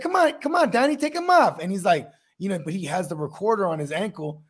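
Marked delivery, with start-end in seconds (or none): 0.0-1.6 coaxing mobster. Retold or imitated.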